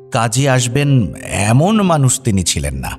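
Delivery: ordinary delivery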